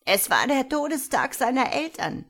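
put-on loud, high old-woman voice; frail old woman. A narrator's reading. Old woman explaining the date to the commissioner, loud frail voice. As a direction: speak up loudly as a frail old lady.

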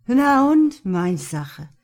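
loud, high-flung defiance, then dropping back; defiant. A narrator's reading. Drunk Josh brushes off Mark's warning, defiant. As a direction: throw it back loudly, then dismiss.

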